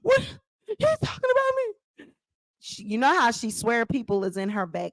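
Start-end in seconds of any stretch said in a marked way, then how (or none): 0.0-1.7 high, gasping disbelief; shocked Tasha. Retold or imitated. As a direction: gasp and squeak it out in shock. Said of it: "And Tasha".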